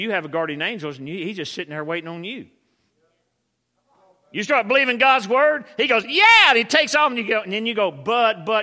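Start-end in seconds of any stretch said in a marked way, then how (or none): 4.3-8.6 loud, raised-pitch animated preaching; preaching at full force. Acted. Preacher acting out faith and doubt for the congregation, full-force preaching. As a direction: drive the story home with big energy.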